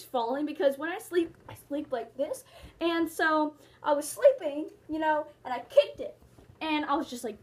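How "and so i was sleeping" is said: ordinary talk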